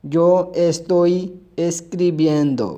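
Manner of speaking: very slow, careful reading for learners; slow model sentence. Shown as a demonstration. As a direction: lay each word down slowly. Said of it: "यानी मैं लिख रहा हूँ".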